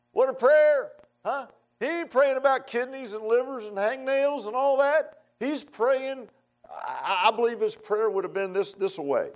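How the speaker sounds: very high, exclaiming pulpit voice; fervent preaching. Real, not acted. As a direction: cry it out to the congregation, pitched high. Preacher exclaiming at full pitch.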